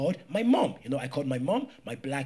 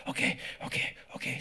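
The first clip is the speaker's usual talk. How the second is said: breathless whisper; 7 dB quieter than usual. Retold or imitated. Panicked reply to nurse.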